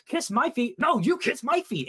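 high-pitched mock yell, drawing out the second demand; half-yelled barbarian demand, pitched well up; retold yell. Barbarian yelling at a goblin to get his way, retold yell. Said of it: "I yelled at him".

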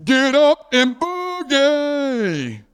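high, long-drawn holler sliding down at the end; stretched-out, high-pitched catchphrase yell; mock scream. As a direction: holler it like a call from far down the road. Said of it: "he would scream out".

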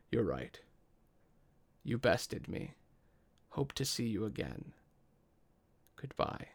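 hushed dragon voice, fading out; soft telepathic voice. Acted. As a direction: speak softly from inside the mind. Dragon speaks in your mind.

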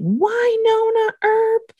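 high, drawn-out sing-out of a name; imitated voice calling from elsewhere; mysterious calling voice. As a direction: call the name slowly in a high put-on voice. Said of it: "she hears this voice saying".

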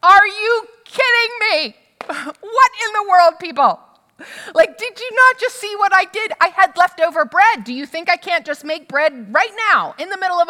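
a dad who finally lost it in the minivan; Jesus losing patience with the disciples, loud and high; exasperated outburst. Retold or imitated. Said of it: "I can imagine that Jesus is just like".

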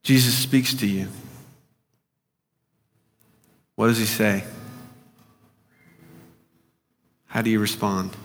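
hushed imaginative prayer guide; guided meditation. Acted. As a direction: lead the listener gently through the scene.

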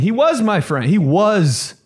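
high, loud wail leaning on "was"; stress on WAS. Acted. Kid telling mom about Luke, wounded stress on "was". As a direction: push "was" up high and hard, hurt kid.

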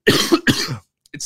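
slow words through a cough. Real, not acted. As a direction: push it out while still coughing. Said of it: "Sorry, I can't stop coughing".

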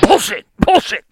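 loud scoffing bullshit; disgusted outburst. Real, not acted. Cutting into the coach's quote with a loud scoff. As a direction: blurt it out in disgust.

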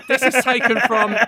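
amused, laughing through the words. Real, not acted. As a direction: try to announce it while laughing. Host cracking up at own clip.

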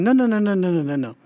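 rapid-fire string of nos, falling away; emphatic repeated no. Retold or imitated. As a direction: imitate an insistent, polite refusal. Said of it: "they're not being rude".

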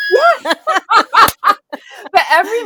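high burst of laughter; laughing hard. Real, not acted. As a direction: laugh out loud through it.

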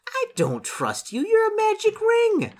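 slow protest climbing to a very high yell; theatrical outcry. Acted. Hero refusing to trust the magic ring, hammed-up high yell. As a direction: build to a high, loud, drawn-out cry.

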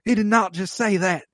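high-pitched, hammed-up scandalized outcry; drawn-out theatrical dismay; mock shocked audience. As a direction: imitate an audience clutching its pearls. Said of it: "And the audience gasps".

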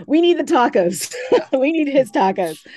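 high, loud laughing insistence; giggly craving for tacos. Real, not acted. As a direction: laugh while demanding the tacos.

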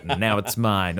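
ordinary talk, nothing marked

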